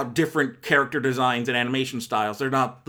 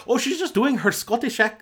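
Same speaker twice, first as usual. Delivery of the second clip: badly attempted higher-pitched Scottish accent; terrible Scottish accent; 8 semitones higher than usual. Reviewer mimicking an actress for laughs, bad Scottish accent. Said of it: "no, no, it's terrible".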